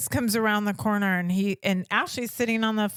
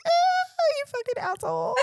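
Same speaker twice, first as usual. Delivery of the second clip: high-pitched hammed-up outrage, pitched way up; dramatic mock outrage; much faster than usual. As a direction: play the dramatic fiancée cursing him out in jest. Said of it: "she's very dramatic, so I'm sure she was like".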